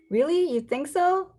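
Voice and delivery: high excited question; girl hoping the name suits her; hopeful surprise. Acted. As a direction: perk up, hoping it's true.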